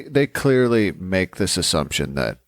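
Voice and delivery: ordinary talk, nothing marked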